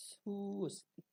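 সুঅস্তি unhurried, with a long first vowel; slow Sanskrit pronunciation. Shown as a demonstration. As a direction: draw out 'suu' in সুঅস্তি, then fall onto 'osti'. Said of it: "একটু সময় নিয়ে বলতে হবে". (সুঅস্তি, সময় নিয়ে টেনে সংস্কৃত উচ্চারণে)

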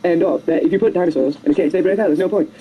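usual speaking voice

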